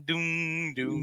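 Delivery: spooky sting; ominous doom sound. Retold or imitated. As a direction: hum a menacing theme for an entrance. Mimicking ominous entrance music.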